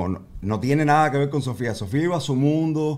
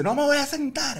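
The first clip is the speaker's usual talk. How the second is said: put-on high toddler voice; 14 semitones higher than usual. Dad voicing his toddler daughter.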